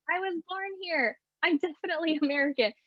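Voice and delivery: pitched high, half-laughing protest; indignant insistence. Retold or imitated. Daughter insisting to mom she's American, mock indignant.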